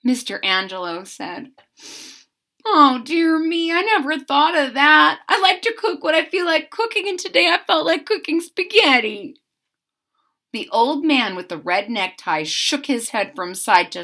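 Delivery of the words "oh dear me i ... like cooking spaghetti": higher baker, fast at the end; a lightly voiced puzzled baker; high fretful cook; baker's voice. A narrator's reading. Angelo answering in story.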